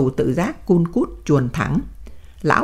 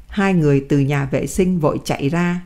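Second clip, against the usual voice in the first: ordinary delivery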